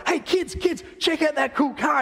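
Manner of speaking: boastful, bright hammed-up call; excited. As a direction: show off the car like a kid. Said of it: "my equivalent of that was like".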